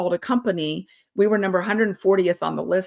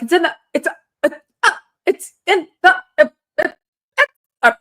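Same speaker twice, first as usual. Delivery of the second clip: imitating a panicked stammer, much higher and louder as it goes; 11 semitones higher, 6 dB louder, faster than usual. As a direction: replay the tongue-tied panic, rising into a squeaky uh. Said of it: "that's literally how I was talking".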